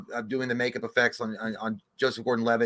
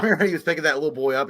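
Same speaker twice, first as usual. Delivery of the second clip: ordinary talk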